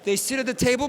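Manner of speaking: ordinary delivery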